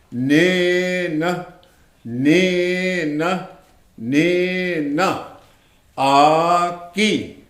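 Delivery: "Nina" and "Aki" slowly, long-held first vowel, evenly repeated; repetition drill. Shown as a demonstration. Teacher drilling new terms into memory, "Nina", "Aki" as slow chant. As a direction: chant "Nina" and "Aki" with stretched, sustained syllables.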